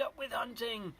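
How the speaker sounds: high-pitched rallying chant; mock shout. A narrator's reading. T-rexes rally to cross the bridge, high mock shout. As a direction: chant it high, not truly yelling. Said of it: "And they shouted".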